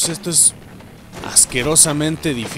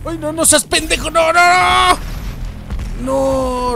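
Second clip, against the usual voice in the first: much higher and louder cries of no; panicked shout; 13 semitones higher, 4 dB louder, slower than usual. Real, not acted. Gamer blundering mid-fight, dismayed shouting. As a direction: yell no in dismay.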